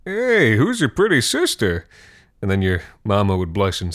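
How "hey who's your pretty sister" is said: higher, playful cheeky compliment; cheeky flirt. Retold or imitated. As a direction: tease the mom with a grin. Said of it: "say something cheeky like".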